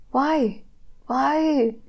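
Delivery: lightly voiced child's plaintive question; childlike calling out. A narrator's reading. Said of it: "my brother called out, like children do".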